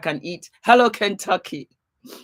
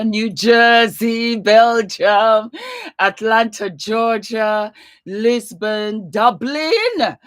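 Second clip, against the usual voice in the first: loud, higher roll call of place names; 5 semitones higher, 7 dB louder, much slower than usual. Real, not acted. Host greeting arriving viewers by location, calling out loudly. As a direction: sing out each place, loudly and high.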